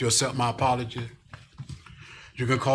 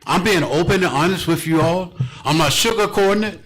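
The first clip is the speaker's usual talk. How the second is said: loud, higher-pitched, emphatic declaration; impassioned insistence; 6 semitones higher, 6 dB louder than usual. Real, not acted. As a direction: drive each statement home, raised and forceful. Mayor pleads insistently to residents.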